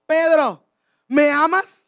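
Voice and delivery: loud call with a rising question. Acted. Christ asks Peter three times, loud calling questioning. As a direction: project the name, then the question. Preacher voices Christ asking again.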